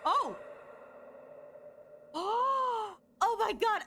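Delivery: high squealing surprise; delighted shock. Real, not acted. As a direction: gasp and squeal it out. Gasps at new form.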